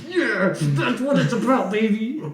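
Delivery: high, laughing gloat; smug gloat. Retold or imitated. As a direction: gloat over the customer, grinning. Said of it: "to just show up a customer like".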